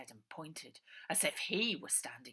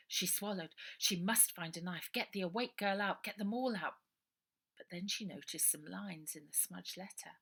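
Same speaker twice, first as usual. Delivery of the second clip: ordinary delivery, nothing marked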